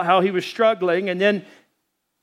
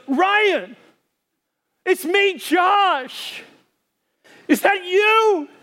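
high, far-carrying shout, slower at the end; shouting from shore; 11 semitones higher, much slower than usual. Retold or imitated. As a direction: yell across the river to be heard. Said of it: "And then he goes".

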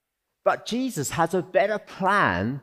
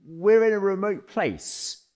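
higher, apologetic, halting plea; hesitant disciple; 8 semitones higher, 4 dB louder than usual. Retold or imitated. Disciples nervously appealing to Jesus about the late hour. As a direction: interrupt him nervously and politely. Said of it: "they appeal to Jesus".

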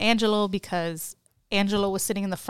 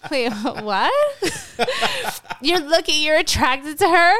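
laughing through it, pitched up and loud; laughing disbelief; 7 semitones higher, 5 dB louder than usual. Retold or imitated. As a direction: giggle out the question in disbelief. Retelling her reply, laughing.

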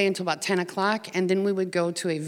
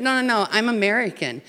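quick, louder, higher insistent no; emphatic correction; 5 semitones higher, 6 dB louder than usual. Retold or imitated. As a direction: set him straight eagerly. Correcting the refugee's assumption, laughing.

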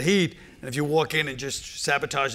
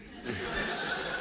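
breathy silent laugh; unvoiced laugh; 10 dB quieter than usual. Real, not acted. Host laughing quietly at the guest. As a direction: laughs under his breath at the joke.

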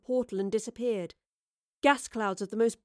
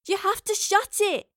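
slow, high-pitched urgent cry; desperate raised-voice plea; crying out over noise; 10 semitones higher, 4 dB louder than usual. A narrator's reading. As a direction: call out over a roaring machine. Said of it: "Astrid cried above the hum, crackle and roar".

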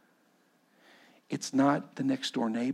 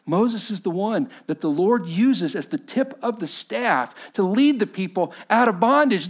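higher and louder, driving the Moses story home; preaching at full force; 12 semitones higher, 9 dB louder than usual. Acted. Preacher thundering the Moses story to the congregation, full-force preaching.

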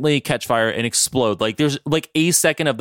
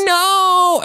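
mock anguished shout; 6 dB louder than usual. Villain sees factory explode.

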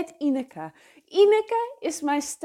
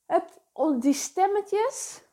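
usual speaking voice

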